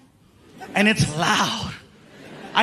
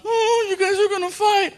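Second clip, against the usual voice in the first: put-on kid voice; 11 semitones higher than usual.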